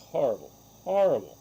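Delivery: loud, high, indignant emphasis; emphatic outburst. Real, not acted. Emphatic condemnation of bad vets.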